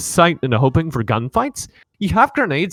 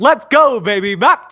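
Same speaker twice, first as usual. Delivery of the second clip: much higher, loud hype yell; hyped shout; 8 semitones higher, 9 dB louder than usual. Real, not acted. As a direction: fire up the crowd. Hype after no sleep.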